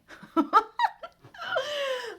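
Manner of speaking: high burst of real laughter; bright laugh. Real, not acted. Reader laughing at her own imitation, high and real. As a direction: laugh at yourself.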